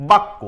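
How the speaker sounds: বাক্য said as "bakko" with doubled k; pronounce bakko. Shown as a demonstration. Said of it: "এটা কি?".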